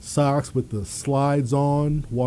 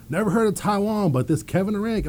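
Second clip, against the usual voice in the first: a hint of the coach, pitched higher; lightly voiced coach; 8 semitones higher, faster than usual. Coach dismisses the player's friend, lightly voiced higher. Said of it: "He's like".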